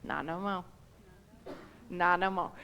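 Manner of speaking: very soft, low, unhurried refusal; quiet firm denial. Retold or imitated. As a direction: lay it down softly as God. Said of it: "he's like".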